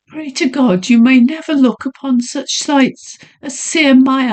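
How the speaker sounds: high, dread-filled lady; haunted young woman. Acted. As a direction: warn him off in fear. Heroine tells of horrors.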